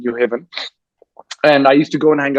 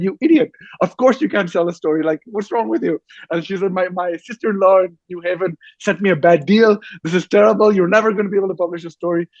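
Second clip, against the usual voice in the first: imitating an exasperated landlady, much higher; mock scolding landlady; 5 semitones higher than usual. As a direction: berate him as the exasperated woman of the house, much higher. Landlady Nana scolds broke student.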